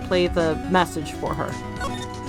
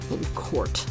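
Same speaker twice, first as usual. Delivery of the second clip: whispered; 5 dB quieter than usual. Acted. Dramatic closing line.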